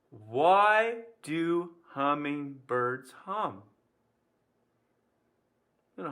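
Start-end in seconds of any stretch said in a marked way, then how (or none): 0.1-3.6 slow, stretched-out riddle question; riddle setup. Pretend. Teacher posing the joke of the day, slow delivery. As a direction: draw out each word of the riddle.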